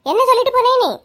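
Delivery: high-pitched scolding demand; angry scolding. Acted. Mother grilling her two forgetful daughters, angry scolding.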